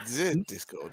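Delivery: playful French "ze" on "Discord"; mock French accent. Co-host teasing the host's wording, mock French accent. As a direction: echo it with a put-on French accent. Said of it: "we have gone French".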